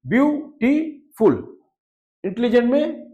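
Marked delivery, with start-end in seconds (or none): 0.1-1.3 ब्यूटीफुल said slowly and higher, the three syllables separated; teacher counting syllables for students. Shown as a demonstration. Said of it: "ब्यूटीफुल में कितने syllable हैं".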